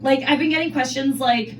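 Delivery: ordinary delivery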